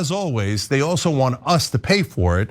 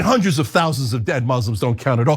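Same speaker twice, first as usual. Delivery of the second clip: normal talking voice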